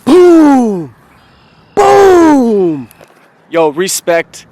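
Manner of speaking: loud triumphant boom; excited shout. Real, not acted. Glassblower celebrating his new piece, loud shout. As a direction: yell it out in victory.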